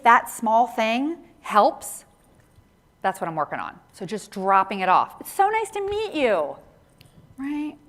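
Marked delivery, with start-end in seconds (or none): 5.2-6.6 "you" lifted high and leaned on at the end; stress on you. Shown as a demonstration. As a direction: land hard on the final "you" in a high friendly greeting. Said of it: "Put the emphasis on the you".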